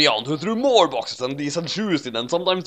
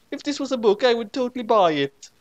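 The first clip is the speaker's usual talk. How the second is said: high excited gush; gushing Naomi; 11 semitones higher than usual. A narrator's reading. Naomi raves about the story, high excited gush. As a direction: squeal with delight.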